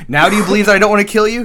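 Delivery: fast, loud pressing question; rapid demand. Retold or imitated. Uncle pressing his nephew for trust, fast loud demand. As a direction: rattle it off, pushing him.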